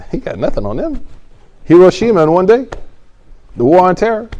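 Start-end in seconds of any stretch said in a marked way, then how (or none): 1.6-4.3 loud, high hammering each name; indignant, forceful listing; emphatic indictment. Real, not acted. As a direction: hit each name hard.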